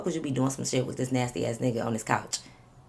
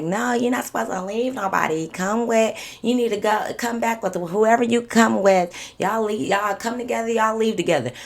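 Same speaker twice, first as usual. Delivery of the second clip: higher-pitched lecturing, imitating critics; mock scolding; 6 semitones higher, 4 dB louder than usual. Imagined listeners scolding her.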